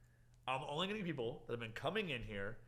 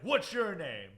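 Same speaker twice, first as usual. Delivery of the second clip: imitated angry yell, higher and louder; yelled demand; 7 semitones higher, 10 dB louder, slower than usual. Angry customer demands the bartender's name, imitated yell. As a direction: mimic a customer yelling at staff. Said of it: "he yelled at me saying".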